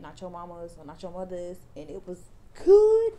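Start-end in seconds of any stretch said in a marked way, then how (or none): 2.6-3.1 excited. Real, not acted. Raving about curling cream.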